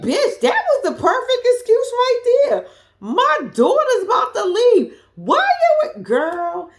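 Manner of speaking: high loud laughing rant; laughing outburst. Real, not acted. Reacting to the show, laughing and yelling high. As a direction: laugh it out while yelling in disbelief.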